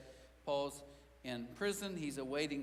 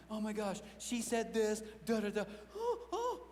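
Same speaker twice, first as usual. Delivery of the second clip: put-on freaking-out teen; 4 semitones higher than usual. Teenager home from school, venting in mock panic. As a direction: gush like a dramatic middle schooler.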